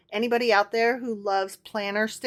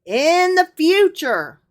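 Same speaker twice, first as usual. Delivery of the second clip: solemn reading; 7 semitones higher, 8 dB louder, slower than usual. Acted. Reads scripture aloud.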